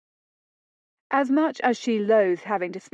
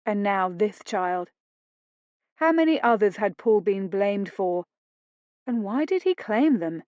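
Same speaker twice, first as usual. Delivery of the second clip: ordinary delivery, nothing marked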